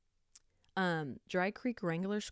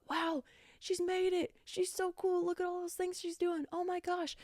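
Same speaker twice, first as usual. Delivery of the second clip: gushing admirers; 11 semitones higher than usual. Retold or imitated. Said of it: "everyone's like".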